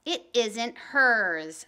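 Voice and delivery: stubborn. Acted. Howie's stubborn reply.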